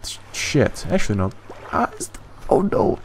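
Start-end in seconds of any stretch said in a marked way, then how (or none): 1.7-3.0 surprised gasp. Real, not acted. Spots something, gasps.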